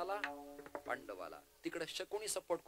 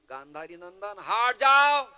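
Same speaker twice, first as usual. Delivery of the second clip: commanding shout, exploding at the end; Bhishma; 5 semitones higher, 19 dB louder than usual. Acted. Bhishma orders Shakuni out of the way, thundering dismissal. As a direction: build to a huge drawn-out yell of dismissal. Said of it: "त्यांनी सांगितलं".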